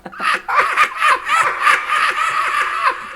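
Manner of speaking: big high laugh; full laugh. Acted. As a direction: burst out laughing. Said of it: "Then she started to laugh".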